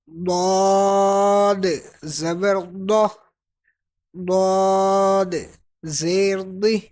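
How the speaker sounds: ضاد stretched slowly with a thick D; full, heavy sound. Shown as a demonstration. Teacher drilling the heavy letters, full ضاد. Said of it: "ان کو آپ نے پر ہی پڑھنا ہے".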